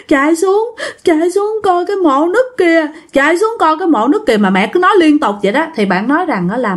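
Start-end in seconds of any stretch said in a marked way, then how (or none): 0.1-4.4 high, tearful wail through the words; imitated crying. Possessed mother urging them to go down, wailing. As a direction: act it out in a high sobbing voice. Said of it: "vừa nói vừa khóc".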